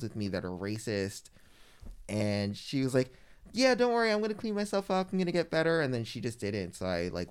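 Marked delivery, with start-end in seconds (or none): 3.5-5.8 breezy promise. Retold or imitated.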